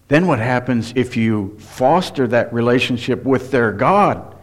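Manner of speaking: ordinary talk, nothing marked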